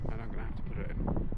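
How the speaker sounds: normal talking voice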